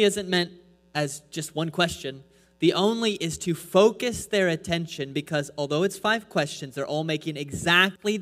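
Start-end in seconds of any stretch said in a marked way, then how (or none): 2.7-3.1 only lifted high and set apart; stress on only. Shown as a demonstration. Preacher drawing the congregation's attention, stressing only. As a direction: point out the word only. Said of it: "you'll notice the".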